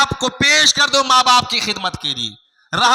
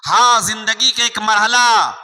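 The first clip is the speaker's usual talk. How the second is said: ordinary talk, nothing marked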